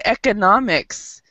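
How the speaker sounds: economics said eh-kuh-NOM-iks with the NOM stressed; stress in the middle. Shown as a demonstration. Teacher modelling economics for the class, stress on NOM. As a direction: put the weight on NOM in economics. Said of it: "the stress is actually in the middle".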